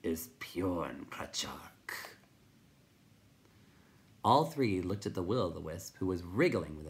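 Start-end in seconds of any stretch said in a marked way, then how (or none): 0.0-2.2 very slow, whispery creature voice; crackling. A narrator's reading. Rock Chewer gives its name, slow crackle. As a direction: crackle it out slowly, barely voiced. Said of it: "the Rock Chewer crackled".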